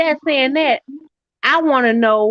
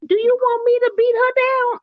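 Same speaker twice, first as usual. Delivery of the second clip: much higher, put-on tough cousin voice; eager cousin; 12 semitones higher than usual. Cousin offering to handle the wife, high put-on voice. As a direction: offer it like a keen scrapper.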